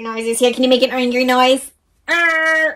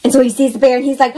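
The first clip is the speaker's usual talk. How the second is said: ordinary delivery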